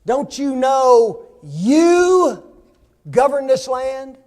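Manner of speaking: a queen rousing her husband, slow and loud; wife rousing husband. Acted. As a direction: stretch each word to shake him awake. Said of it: "she says".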